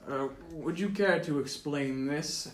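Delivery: lightly voiced guard, slow and measured; slow, politely probing officer; guard captain. Guard confronts party with a wanted poster, slow formal voice. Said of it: "and he says".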